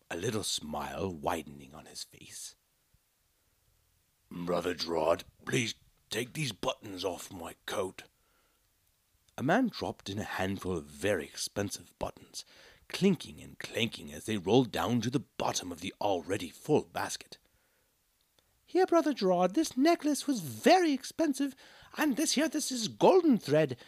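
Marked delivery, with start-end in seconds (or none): 4.3-8.0 a hint of a different man's voice; lightly voiced man in the crowd; lightly voiced townsman. Townsman offers his buttons. 18.7-23.8 much higher and louder, pushing gifts forward; excited high villager voice; eager crowd. Acted. Crowd thrusting gifts, loud, high.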